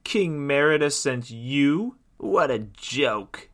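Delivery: drawn-out queenly mockery; scornful evil queen. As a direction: scoff slowly in disbelief. Mocking queen scoffs at Rita.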